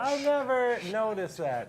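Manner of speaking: real laugh running into the words; amused laughing. Real, not acted. Co-host reacting to a horse name's tongue twister, amused laughing.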